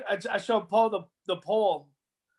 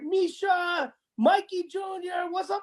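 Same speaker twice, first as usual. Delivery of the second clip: high excited radio shout-out; 8 semitones higher, slower than usual. Retold or imitated. Radio host shouting out to the kids. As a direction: call the kids' names like a DJ.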